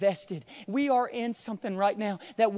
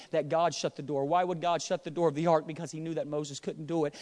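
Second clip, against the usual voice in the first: ordinary delivery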